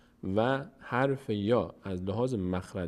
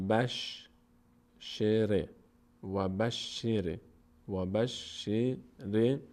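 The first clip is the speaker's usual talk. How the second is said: very slow, drawn-out Arabic syllables; syllable-by-syllable recitation; much slower than usual. Shown as a demonstration. Teacher showing students how it reads, syllable-by-syllable recitation. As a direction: sound it out slowly, holding each syllable.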